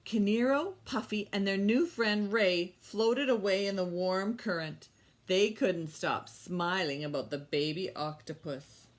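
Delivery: put-on bright, lively story read for kids; storytime reading. Teacher reading a story to her young class. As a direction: read it like a picture book to little ones. Reads story aloud to children.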